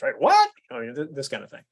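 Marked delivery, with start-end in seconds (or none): 0.2-0.5 "What?" thrown up high and loud in disbelief; mock outraged customer; indignant American "What?". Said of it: "It's a total American response".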